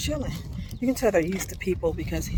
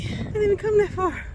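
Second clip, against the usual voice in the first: much higher incredulous whine; exasperated; 13 semitones higher, 4 dB louder than usual. Real, not acted. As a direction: squeak it out in disbelief. Exasperated high complaint while winded.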